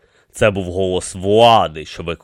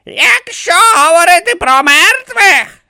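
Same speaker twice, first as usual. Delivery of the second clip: high, loud proclamation; defiant; 8 dB louder than usual. A narrator's reading. Pharrepta leaps forward against the pyramids, defiant. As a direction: declare it forcefully in a raised voice.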